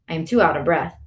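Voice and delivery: usual speaking voice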